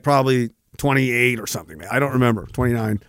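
usual speaking voice

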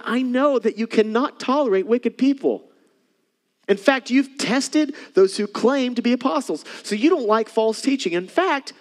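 high, loud, emphatic sermon voice; rising pulpit fervour; fervent preaching. Acted. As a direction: preach it out to the congregation. Preaching at full force.

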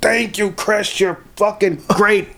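high, slow, sloppy gratitude; imitating a drunk. Drunk customer thanking the bartender, high and slow. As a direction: gush like a drunk leaning on you.

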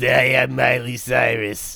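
man doing a woman's voice; slow, girly pop star impression; [name] voice. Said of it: "I sound like, uh, [name]".

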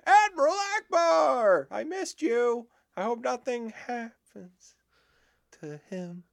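high, slow fading voice; hammed-up wistful goodbye; mock tender farewell. Voicing a movie character.

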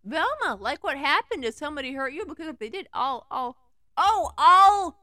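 building to a mild mock angry outburst; rising from worried to a loud angry sputter; Shaggy worked up, a touch of anger; angrily. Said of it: "he said angrily".

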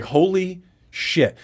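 stunned awe. Retold or imitated. Storyteller reliving the aftermath, stunned. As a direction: let it out slowly, astonished.

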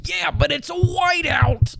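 high, slow stubborn shout; mock shout. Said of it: "Aren't you playing Northwestern?".

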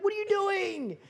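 high, incredulous protest; can't believe he just said that; shocked protest. Retold or imitated. Eavesdropper reacting to Jesus' bold question, high incredulous protest. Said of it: "And you're like".

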